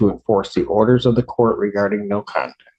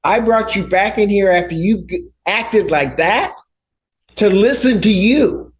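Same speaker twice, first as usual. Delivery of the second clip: angry; 10 semitones higher than usual. Real, not acted. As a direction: scold her sharply from the bench. Judge scolds defiant woman.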